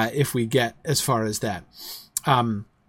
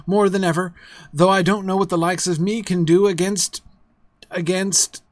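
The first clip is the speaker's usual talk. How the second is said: faltering; 5 semitones higher, 5 dB louder than usual. Acted. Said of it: "he faltered".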